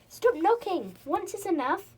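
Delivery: high, loud, cross shout; loud angry voice. Acted. Grumpy pixie yelling through his door, loud and angry. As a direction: bellow at them to stop knocking. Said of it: "A loud voice came from inside".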